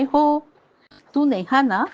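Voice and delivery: a hint of a sweet, smiling grandmother; sweet old lady. A narrator's reading. Frail mother-in-law greeting a visitor, lightly sweet and smiling. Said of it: "त्या गोड हसल्या माझ्याकडे पाहून".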